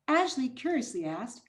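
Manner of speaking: ordinary delivery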